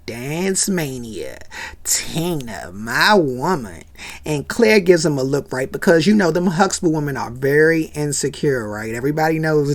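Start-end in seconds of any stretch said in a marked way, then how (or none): 0.0-3.8 low, slow smitten croon; smitten dad. Retold or imitated. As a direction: savour her name like a crush. Cliff lustful low.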